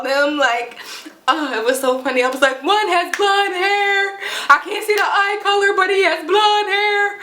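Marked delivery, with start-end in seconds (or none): 2.6-7.2 high, drawn-out mock panic; freaking out. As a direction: wail the description as if panicking on a call. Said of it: "I'm, like, freaking out still".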